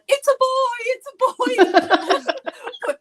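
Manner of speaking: put-on high, loud, laughing triumphant yell of it's a boy; mock yell. Retelling the nativity play, Basil Fawlty yells out a laughing high shout. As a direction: bellow it out like Basil Fawlty with a laugh breaking through. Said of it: "he yells out".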